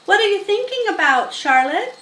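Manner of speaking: pig voice. A narrator's reading. Wilbur voiced asking.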